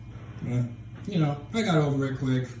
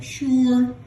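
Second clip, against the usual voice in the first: high, drawn-out "sure"; mock insecure answer; 11 semitones higher, 4 dB louder than usual. Comic mocking how people answer a question, high and insecure. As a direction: stretch it high and noncommittal. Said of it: "I don't like it when people say".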